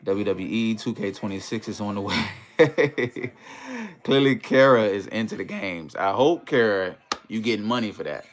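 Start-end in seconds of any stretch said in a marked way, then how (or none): 2.3-4.0 high, drawn-out burst of laughter; real amused laugh, pitched well up. Real, not acted. Streamer amused by a viewer's game comment, high laugh.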